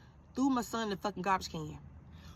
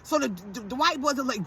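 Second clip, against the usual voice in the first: normal talking voice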